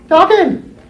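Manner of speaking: very high, loud punchline; exclaimed answer. Acted. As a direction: shout the answer out for the class. Teacher call-and-answer punchline.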